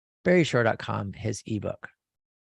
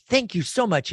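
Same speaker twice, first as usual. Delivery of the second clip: high gushing thanks; 9 semitones higher than usual. Retold or imitated. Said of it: "you'd say".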